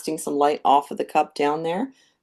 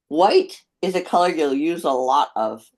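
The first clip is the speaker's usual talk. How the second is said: mouth full; 4 dB louder than usual. Real, not acted. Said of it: "I had my brush in my mouth".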